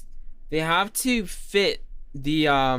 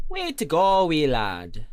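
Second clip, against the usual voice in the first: Scottish accent; 3 dB louder than usual. Shown as a demonstration. Streamer proving it wasn't Jamaican, in a Scottish accent. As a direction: show off your Scottish accent. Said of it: "That was [name]'s Scottish accent".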